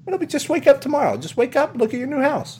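very high, breezy salesman patter; Tom Nook. Acted. Salesman promising a house tomorrow, high patter. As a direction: reassure the customer in a high put-on voice. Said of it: "And he's like".

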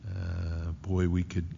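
normal talking voice

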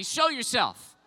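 shouted back at the congregation; loud shouted echo. Shown as a demonstration. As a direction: shout it to the crowd. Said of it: "come on, shout it at me".

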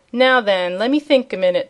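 ordinary delivery, nothing marked

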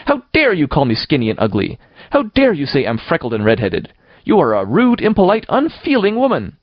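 put-on high-pitched outraged child, building; furious childish outburst; passionate indignation. A narrator's reading. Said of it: "passionate indignation exhaling from her".

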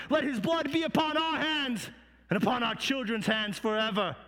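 high, forceful cry easing at the end; crowd crying out. Acted. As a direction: call it out as a defiant mob. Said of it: "And they cried out".

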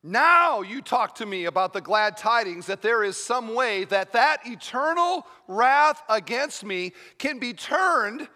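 loud, high, urgent pulpit delivery; full-force preaching. Acted. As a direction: drive each phrase home to the congregation.

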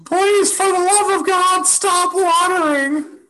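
sunflower voice. Acted. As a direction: beg like a tiny screaming flower. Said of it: "Can you say it in sunflower voice?".

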